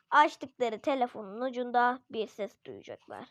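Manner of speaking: ordinary delivery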